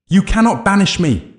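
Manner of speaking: very high, loud defiant scream. A narrator's reading. Dark figure resisting banishment, high furious scream. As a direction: scream it with full force, pitched high. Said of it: "the figure screamed".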